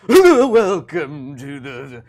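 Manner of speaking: put-on slow, loud drawling drunk pitchman; loud, slow boozy commercial voice; drunk Vincent Price ad. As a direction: slur it out like a tipsy wine ad. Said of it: "subtly more drunk and drunk".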